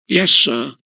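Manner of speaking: put-on a grunt from the old porter; gruff, short and put out. A narrator's reading. Old porter Gosling answers the schoolmaster, gruff grunt. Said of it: "grunted Gosling".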